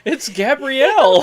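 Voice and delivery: high, loud, slow, laughing; mock the name, laughing; teasing mispronunciation. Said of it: "I would call him Gabrielle".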